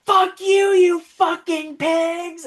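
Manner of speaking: hammed-up hippie girl shrieking at the cops; imitated furious shout. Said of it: "she's like".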